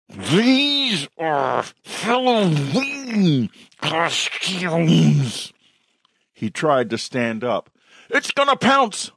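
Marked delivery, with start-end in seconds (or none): 0.1-5.5 extremely slow, high, stretched-out growl; laboured werewolf howl. A narrator's reading. Werewolf retries explaining to police, howling each word very slowly. 8.1-9.1 very high alarmed warning; panicked cry. A narrator's reading. Onlooker warns police of the wolf, crying out very high.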